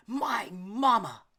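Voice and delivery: loud, high, slow yell; bellowing. A narrator's reading. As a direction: shout it back at the bully. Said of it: "Elmer bellowed back".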